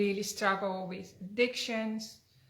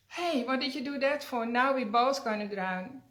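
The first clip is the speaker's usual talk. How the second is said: louder, higher cry of betrayal; dismayed outcry; 4 semitones higher, 6 dB louder than usual. Acted. Dying frog cries out at the scorpion, louder and higher. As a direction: shout it out as the sting hits.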